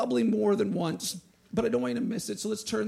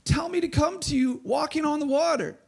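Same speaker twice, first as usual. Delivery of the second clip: higher, raised calling voice; 8 semitones higher, 4 dB louder than usual. A narrator's reading. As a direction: call across the water to someone. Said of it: "Peter called to him".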